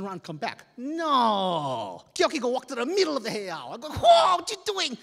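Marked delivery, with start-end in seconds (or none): 3.9-5.0 high, loud and slow alarm; alarmed cry. Retold or imitated. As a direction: call out in panic. Alarmed at friend going in.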